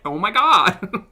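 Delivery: put-on drawn-out, high, loud Valley Girl; Valley Girl accent. Shown as a demonstration. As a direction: stretch it out like a mock Valley Girl. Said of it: "only phrase I've got down for Valley Girl".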